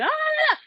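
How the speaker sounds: high-pitched mock shriek; wordless squealing yell, imitating someone; imitated yelling. Woman retells a girl yelling at Tory, imitated yelling. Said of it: "And she was yelling".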